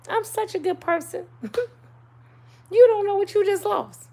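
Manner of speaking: preen over your own goodness, teasing; mock self-praise. Shown as a demonstration. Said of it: "I feel so bad for you 'cause I'm such a good person".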